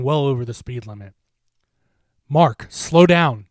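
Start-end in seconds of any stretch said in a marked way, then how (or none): 2.3-3.5 slow, loud frightened plea. A narrator's reading. Jen pleads with speeding Mark, scared shout. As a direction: beg him to slow down. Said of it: "Jen said".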